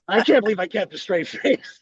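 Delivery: normal talking voice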